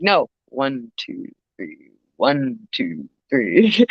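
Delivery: low, deliberate counting beats; counting to the metronome. Retold or imitated. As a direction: count himself down slowly to stay calm. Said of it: "remember the inner metronome".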